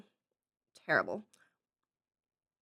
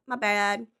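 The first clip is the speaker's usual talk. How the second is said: normal talking voice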